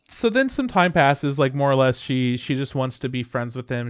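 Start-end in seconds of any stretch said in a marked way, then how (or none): none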